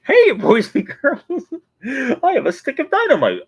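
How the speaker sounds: squeaky showman announcing a stunt; cartoon character impression. Quoting Pouchy from Inside Out Two, high loud cartoon voice. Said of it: "It's like".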